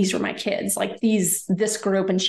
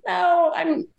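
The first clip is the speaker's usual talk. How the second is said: high, imitated tearful protest; retold distressed denial; 9 semitones higher than usual. Grieving patient admitting the truth to gynecologist, high imitated distress. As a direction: pitch it up, imitating someone breaking down.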